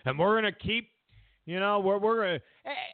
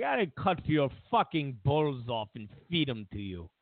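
menacing Putin; 5 semitones lower than usual. Retold or imitated. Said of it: "Putin's like".

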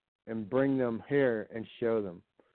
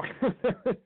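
high laugh; 12 semitones higher than usual. Real, not acted. Friends joking.

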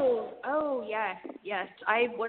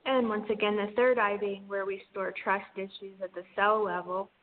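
airy breathy mystical healer. Acted. Guides an energy healing session.

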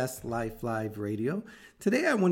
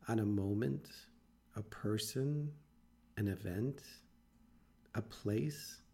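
guided meditation; 4 dB quieter than usual. Acted. Coach walking listeners through a quiet visualization, guided meditation.

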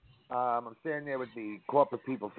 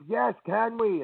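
eager; 11 semitones higher, slower than usual. Retold or imitated. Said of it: "I'm like".

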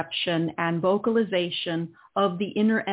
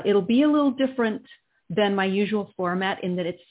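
ordinary talk, nothing marked

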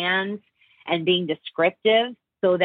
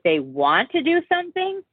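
asking tone; 7 semitones higher than usual. Retold or imitated. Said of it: "come off more like they are asking".

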